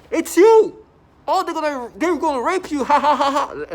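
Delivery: high, loud mock scream ending in a cackle; imitated screaming. Imitating hallway neighbours screaming at him, then laughing. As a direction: shriek it, then laugh wildly. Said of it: "I can hear them in the hallway scream".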